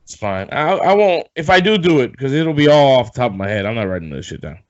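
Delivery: normal talking voice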